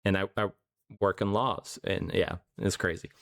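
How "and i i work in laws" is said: normal talking voice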